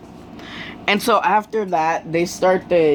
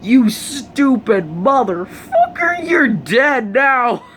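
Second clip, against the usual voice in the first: high, drawn-out gleeful taunt; Kacchan; 6 semitones higher, slower than usual. Acted. Classmate jeering at the doomed villain, taunting. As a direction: stretch out the threat in a high voice. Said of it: "Kacchan's just like".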